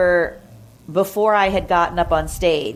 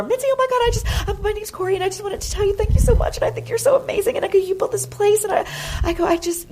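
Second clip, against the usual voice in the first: much higher, fast starstruck gushing; breathless fangirl gush; faster than usual. Retold or imitated. Starstruck young comic gushing at club owner, much higher and fast. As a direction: squeal it out like a starstruck fan meeting a hero.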